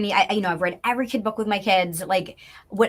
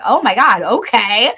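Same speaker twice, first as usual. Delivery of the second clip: a stunned oh my God; surprised; 8 dB louder than usual. Retold or imitated. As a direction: caught off guard, rising okay. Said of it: "I'm like".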